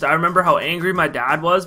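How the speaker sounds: usual speaking voice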